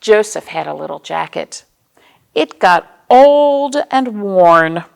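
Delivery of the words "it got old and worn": very slow, louder refrain; old and worn, drawn out and emphatic; slow storybook refrain. A narrator's reading. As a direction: stretch each word out for the kids. Refrain read to children.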